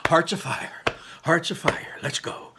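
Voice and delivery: whispered pep talk to himself; muttered to himself. Acted. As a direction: whisper it under your breath to psych up. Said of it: "Ian muttered to himself".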